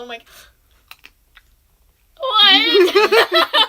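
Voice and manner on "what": high, loud shocked laugh; a squealed laughing what. Retold or imitated. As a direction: burst out in laughing disbelief. Friend ate all the blue ones.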